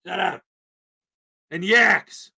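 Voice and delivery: usual speaking voice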